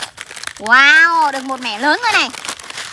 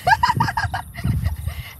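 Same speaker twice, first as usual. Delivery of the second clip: giggle; 6 dB quieter than usual. Real, not acted. Kid laughs at cow's bottom.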